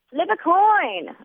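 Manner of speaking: high, slow, imperious order; drunken demand. A narrator's reading. Drunk girl ordering the nuns at the door, high slow demand. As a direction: boss them around like a tipsy queen. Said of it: "I demand".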